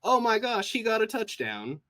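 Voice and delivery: high, loud, thrilled sports cheer; excited American fan. Shown as a demonstration. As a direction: gush like a fan whose team just scored. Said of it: "I need a character that says".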